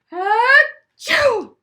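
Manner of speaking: put-on expressive read-aloud for children; reading a picture book to a class; story narration. A narrator's reading.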